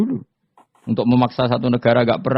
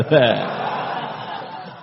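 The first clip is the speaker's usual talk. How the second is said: breathy laugh. Real, not acted. Laughing at own story.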